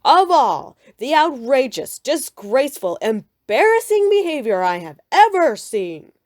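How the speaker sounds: loud, angry fatherly rant; Papa venting to Mama, loud angry roar. A narrator's reading. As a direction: roar out your outrage at the cubs. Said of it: "he roared".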